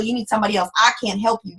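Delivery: ordinary talk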